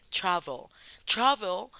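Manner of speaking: "Travel" with a strong TRA and weak -vel; first-syllable stress. Shown as a demonstration. Teacher pointing out the tonic syllable, first-syllable stress on "Travel". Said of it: "tónica es tra".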